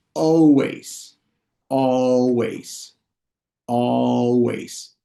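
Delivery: drawn-out, carefully modelled English word; slow model pronunciation. Shown as a demonstration. English teacher models "always" for students to repeat, slowly. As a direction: stretch each syllable for learners to copy. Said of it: "lo voy a decir lento".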